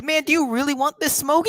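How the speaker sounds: high, mocking movie-character voice; amused taunt. Dame answering Donnie's threat, high amused taunt. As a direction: imitate someone laughing off a threat. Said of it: "he's just laughing in his face".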